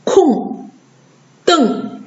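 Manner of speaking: 空, 瞪 falling, each ending in plain ng; back-nasal demo. Shown as a demonstration. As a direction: read 空 and 瞪 singly, no r. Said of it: "空就是后鼻音".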